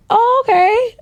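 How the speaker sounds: high, surprised "Oh, okay"; startled agreement; pleasantly surprised. Retold or imitated. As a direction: sound caught off guard. Surprised he'll pay her.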